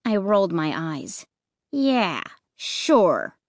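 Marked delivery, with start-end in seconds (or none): 1.7-3.4 dry, dismissive sure; sarcastic. Acted. Narrator mocking Luke's reassurance, sarcastic. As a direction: roll your eyes as you say it. Eye roll, sarcastic reply.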